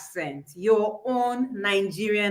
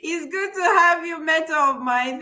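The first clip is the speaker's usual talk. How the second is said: higher, delighted exclaim; excited greeting; 5 semitones higher than usual. Real, not acted. Host greets her mentor on air, delighted and higher. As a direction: burst out with joy at the guest.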